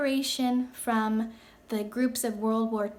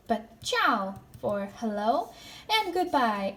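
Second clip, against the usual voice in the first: normal talking voice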